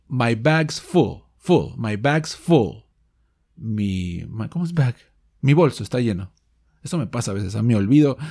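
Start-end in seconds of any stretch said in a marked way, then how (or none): 0.1-2.8 "My bag's full" read slowly with a short u in full; slow teacher model; slow model sentence. Shown as a demonstration. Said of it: "La número uno".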